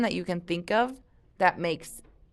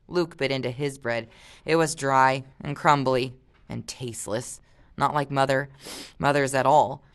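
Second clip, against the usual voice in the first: plain reading voice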